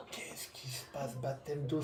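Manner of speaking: hushed, baffled whisper; whispered disbelief. Retold or imitated. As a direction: murmur it as if stunned. Stupeur devant la vidéo, chuchoté. (murmure stupéfait)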